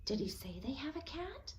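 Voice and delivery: soft, breathy worried whisper; whispered alarm. A narrator's reading. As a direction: lean in and whisper the fear. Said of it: "She nodded toward Uncle Pete".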